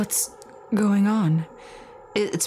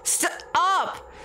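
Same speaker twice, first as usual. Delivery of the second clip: panicked cry; 6 semitones higher than usual. Acted. Being buried alive.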